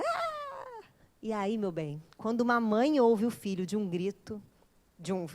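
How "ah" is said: long, very high, soft scream; thin drawn-out imitation shriek; mock distant scream. Mother hears her daughter scream from afar, mock scream. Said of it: "Ela só ouve o grito da filha".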